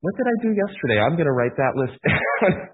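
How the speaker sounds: high, fast, laughing at yourself; self-mocking quote. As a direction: toss it off with a laugh. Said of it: "I'm like".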